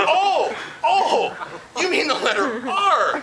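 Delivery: high, slow, laughing sarcasm; mock realization. Agent mocking the caller with fake sudden understanding. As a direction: act out dawning surprise, teasing.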